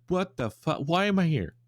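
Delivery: a yeti yanked somewhere out of nowhere; confused yeti. Retold or imitated. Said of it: "that's the Yeti's immediate reaction".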